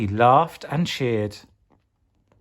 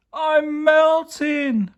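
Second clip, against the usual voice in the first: very high, slow, stretched "I'm melting!"; pleading; 4 dB louder, much slower than usual. Acted. Lolly melting in the freezer, slow high plea. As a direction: wail it out slowly. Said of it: "pleaded Lolly".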